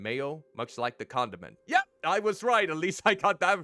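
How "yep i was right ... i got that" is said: excited. Real, not acted.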